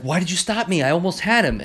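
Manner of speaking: high-pitched mock frustration; exasperated, acted lightly; retold angry protest. Retold quarrel, Scourge protests to Optimus.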